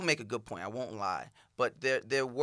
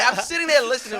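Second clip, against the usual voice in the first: laughing; 10 semitones higher, 7 dB louder than usual. Real, not acted. Amused retelling.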